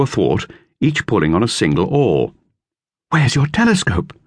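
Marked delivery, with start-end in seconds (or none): 3.1-4.1 higher, lightly voiced girl; girl's voice, lifted higher; Dorothea. A narrator's reading. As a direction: read it as young Dorothea. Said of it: "said Dorothea".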